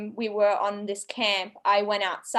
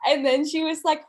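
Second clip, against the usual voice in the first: ordinary talk, nothing marked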